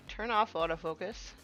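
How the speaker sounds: ordinary talk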